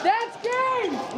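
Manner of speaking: excited shout. Real, not acted. Announcing win.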